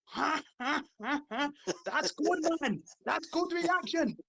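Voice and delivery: high fake laugh and accent; staccato ha-ha, then high, drawn-out accented praise; mock German reaction. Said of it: "here was the actual reaction the Germans".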